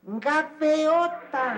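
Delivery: very slow "Gaviota" said as "gaveota"; "Gaviota" as ga-ve-o-ta, slowly; hypercorrected pronunciation. Acted. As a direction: stretch "Gaviota" with an e for the i. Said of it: "esta sí es gaviota".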